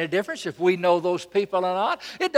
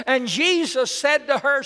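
ordinary talk

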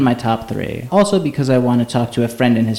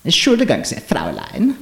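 leaned-in German accent. Shown as a demonstration. Showing friends well-pronounced German, leaning hard into the German accent. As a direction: savor each German word in full accent. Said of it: "I lean into the accent".

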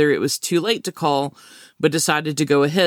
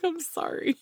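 laughing apology; 13 semitones higher, 9 dB quieter than usual. Retold or imitated. Apologizing while cracking up.

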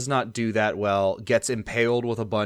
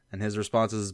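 normal talking voice

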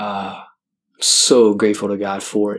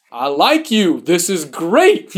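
giddy relief; 8 semitones higher, much slower than usual. Retold or imitated. Relieved after priest's advice.

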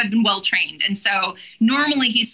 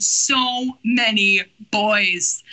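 very slow, comically overwhelmed; slow, weighty comic repeat; drawn-out emphasis; much slower than usual. Real, not acted. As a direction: stretch out each word in mock dismay.

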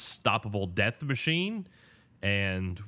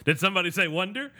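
light, high, fast showman pop-in; Tony Wonder impression; 9 semitones higher, 5 dB louder, faster than usual. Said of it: "one of those Tony Wonder moments".

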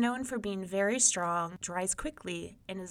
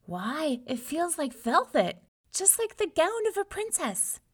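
pleased wonder; 9 semitones higher, 4 dB louder than usual. A narrator's reading. Hero marvels at soft fur.

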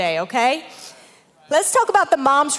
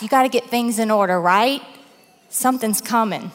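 ordinary delivery

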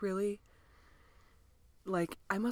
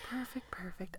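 whispered; 12 dB quieter than usual. Acted. Whispered repeat after loud perfect.

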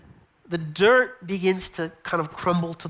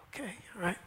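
soft whispered okay; whispered agreement; 14 dB quieter, faster than usual. Retold or imitated. Disciples answering the Lord, whispered. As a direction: agree in a hush.

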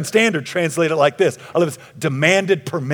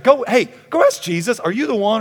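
raised, hurried order to a runner; mildly agitated, higher and louder; urgent instruction; 8 semitones higher, 5 dB louder than usual. Acted. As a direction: send someone off on an urgent errand. Voicing John sending a runner.

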